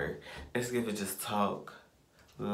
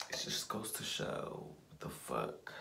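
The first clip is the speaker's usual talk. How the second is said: slow, low, breathy whisper; whispered exasperation; 9 semitones lower, 9 dB quieter, slower than usual. Real, not acted. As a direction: let it trail off under your breath. Exasperated trailing mutter.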